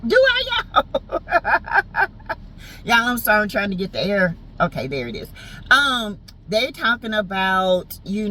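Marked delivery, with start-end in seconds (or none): 1.3-2.1 high-pitched laugh; giggling at yourself. Real, not acted. Laughing at own opening remark.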